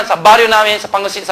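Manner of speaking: ordinary talk, nothing marked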